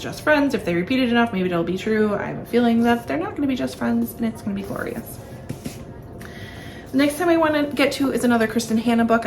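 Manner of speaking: normal talking voice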